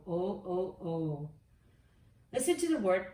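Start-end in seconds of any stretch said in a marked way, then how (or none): 0.1-1.3 O repeated slowly; letter sound O. Shown as a demonstration. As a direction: drill the O sound. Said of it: "beginning sound of L is O".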